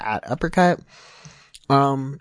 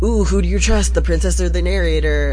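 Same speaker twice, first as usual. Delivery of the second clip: higher, teasing mystery voice; mock dramatic intrigue; 6 semitones higher than usual. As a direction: ham up the big choice. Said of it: "at the start is very, like".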